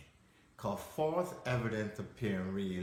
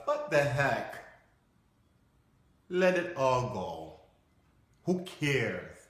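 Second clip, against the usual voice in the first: louder, throwing caution away; carefree defiance; 3 semitones higher, 5 dB louder, slower than usual. Acted. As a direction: shrug it off boldly. Acts out letting fear go.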